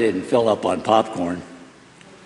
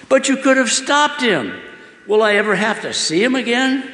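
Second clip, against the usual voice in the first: much higher, pleading and scared; frightened plea; 9 semitones higher than usual. Acted. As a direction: ask God, afraid of seeing him again. Boy pleads with God.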